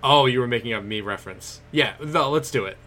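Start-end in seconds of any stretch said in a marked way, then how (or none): none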